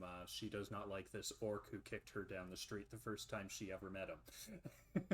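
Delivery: ordinary talk, nothing marked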